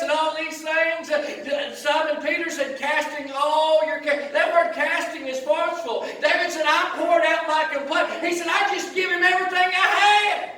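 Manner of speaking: high, loud, driving sermon delivery; full-force preaching. Real, not acted. Preacher urging the congregation to cast cares on God, full-force preaching. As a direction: hammer it out, peaking on a shouted finish.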